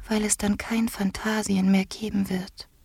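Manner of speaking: high, soft whispery voice; tender as a bird. A narrator's reading. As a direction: explain gently in a fragile hush. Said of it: "hörte er die vogelzarte Stimme".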